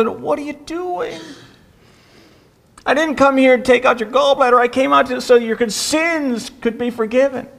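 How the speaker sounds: the doctor as Paul, protesting the praise. Retold or imitated. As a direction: insist hard, correcting the crowd. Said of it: "And Paul goes".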